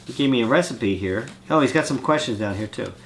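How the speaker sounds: ordinary talk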